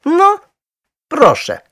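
loud, high, triumphant announcement; proud. A narrator's reading. Husband showing wife the dark corner, proudly triumphant. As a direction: present it like a proven winner. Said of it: "z dumą oznajmił pan Brown".

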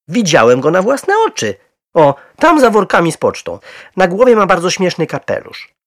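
loud, high, excited insistence; insistent. A narrator's reading. Husband to wife about the bear he saw, excitedly insistent. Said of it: "upierał się".

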